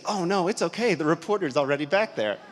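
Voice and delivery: high, loud, breezy reassurance; loud, upbeat, naive reassurance; cheerful blurt. Retold or imitated. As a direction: blurt it out cheerfully, not knowing better. Photographer cheerfully giving them away.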